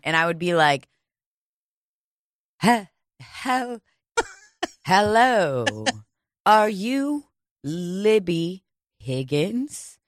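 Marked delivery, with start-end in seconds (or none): 2.6-10.0 slow, formal, hesitant hello; playing a classy process server, slowly; posh Manhattan voice. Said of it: "trying to be a Manhattan font".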